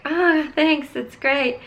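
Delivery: bright, high fake cheer to hide disappointment; chirpy forced politeness, voice up high; fake polite thanks. To the hairdresser, hiding her dismay behind fake cheer. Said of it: "'cause I can't do confrontation".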